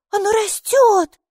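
high, loud, drawn-out exclamation; excited child. A narrator's reading. Майки feels it growing, high excited child voice. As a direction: announce it high and slow.

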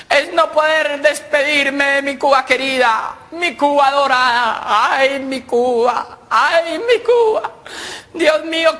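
high, slow quavering lament; dying old man lament. Retold or imitated. Dying Cuban old man mourns his Cuba, slow and high. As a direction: drag out every word like the last breath.

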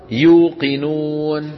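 "يوقنون" very slow, long yuu and noon; changed easy form. Shown as a demonstration. Teacher introduces the example, "يوقنون" very slow with long uu. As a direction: draw out "يوقنون" as yuu-qi-nuun. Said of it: "قلبوا الياء واوا".

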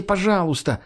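put-on high, pleading child-like wail; wailing plea. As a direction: wail for help like a scared kid. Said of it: "заголосил он". (жалобно заголосил)

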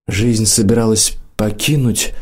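ordinary delivery

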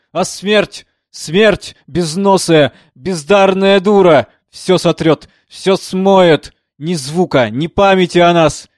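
high, loud raging outcry; furious shout. Acted. Composer screaming at death, furious roar. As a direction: rage at death with full voice. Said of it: "закричал с яростью".

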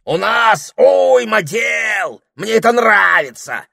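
high, slow, shouted outburst; a fussy parrot losing his temper; flaring parrot. Acted. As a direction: blow up at them like a hot-tempered parrot. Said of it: "вспылил попугай".

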